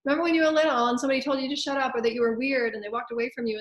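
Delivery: mock-yell the fearful doubts at yourself; psyche yelling. The frightened psyche yells warnings at you, loud and high. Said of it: "it's yelling at you saying".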